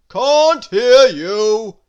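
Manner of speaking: slow, very loud grocer's shout; drawn-out bellow. A narrator's reading. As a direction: shout it out, stretching every word. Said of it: "shouted the grocer".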